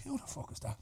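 hushed whisper of surprise. Retold or imitated. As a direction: whisper it under your breath. Barman reacting under his breath.

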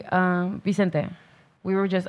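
ordinary talk, nothing marked

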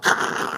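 imitated rushing spray of a hose; mouth-made water blast; fire hose noise.

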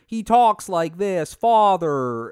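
put-on slow, loud seventies child-actor accent; seventies American accent. Little kid actor in Drummer Boy, seventies American accent. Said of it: "a distinct American seventies accent".

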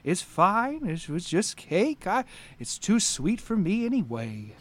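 put-on soft, calming old man; soothing old tortoise knight. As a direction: settle him down gently. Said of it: "And he says".

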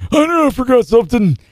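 put-on high, creaky old geezer voice; old man voice. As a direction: play a feeble old man. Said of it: "Monty's laughing at my old man voice".

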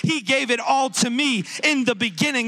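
emphatic, insistent sermon delivery; full-force preaching. Real, not acted. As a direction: hammer each declaration home to the congregation.

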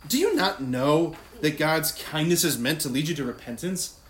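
loud, accusing rhetorical question; wrong, confrontational tone. Shown as a demonstration. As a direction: throw the verse out as a scolding challenge. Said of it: "that's not the tone".

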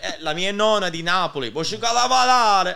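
high, loud playful Italian; mock Italian reply. Answering the old cab driver, loud hammed-up Italian. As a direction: play along in hammed-up Italian. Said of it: "I'm like".

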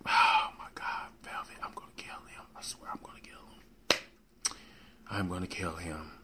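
hissed whisper through clenched teeth; exasperated whisper about the late co-host; whispered fury. Real, not acted. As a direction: whisper the threat, seething. Furious at absent co-host.

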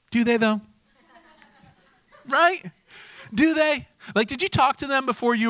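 high, loud, laughing disbelief; incredulous. Real, not acted. Preacher doubting the contestant's friends, high and loud. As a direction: pitch it way up in mock disbelief.

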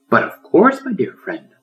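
smiling, kind reply; happy smile. A narrator's reading. Lion agreeing to read to turtle, smiling. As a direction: answer with a warm smile. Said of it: "the lion happily smiled".

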